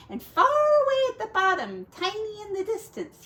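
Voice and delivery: high, loud lift on far away; far away emphasis. A narrator's reading. Reader stretching far away for kids. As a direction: reach up to paint the distance. Narrating distance, big lift.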